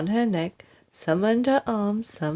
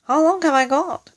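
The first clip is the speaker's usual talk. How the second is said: high, louder anxious cry; cried; 9 semitones higher, 6 dB louder than usual. Acted. Mrs Twit begs husband for time, high anxious cry. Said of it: "cried Missus Twit".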